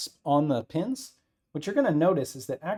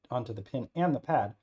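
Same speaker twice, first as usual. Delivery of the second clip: ordinary talk, nothing marked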